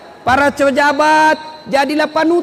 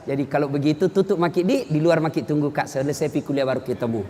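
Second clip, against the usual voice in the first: polite dismissal; 10 semitones lower, 7 dB quieter, faster than usual. Retold or imitated. Lecturer politely sends student out.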